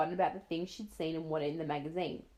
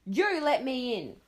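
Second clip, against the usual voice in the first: stern demand; 6 semitones higher, 6 dB louder, slower than usual. Acted. Mother demanding at door.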